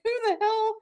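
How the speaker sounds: high, laughing mock outrage; mock indignant, real laughs; laughing indignation. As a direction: demand it while laughing. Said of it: "And I'm like".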